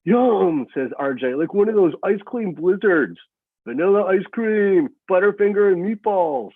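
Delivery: high, loud, mock-delighted food list; boy teases girl about her surgery diet, mocking; making fun. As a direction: tease her with fake relish. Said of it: "He's making fun of it".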